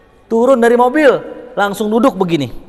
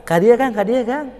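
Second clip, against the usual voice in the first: polite Sundanese; 7 semitones higher than usual. Retold or imitated. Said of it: "Kalau Sunda harus".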